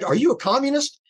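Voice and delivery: high, chirpy, cheerful question; very bright. Retold or imitated. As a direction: ask it brightly, all sunny. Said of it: "very brightly said".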